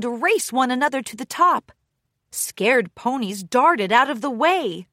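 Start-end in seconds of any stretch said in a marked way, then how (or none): none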